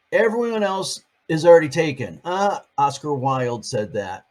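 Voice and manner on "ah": ordinary delivery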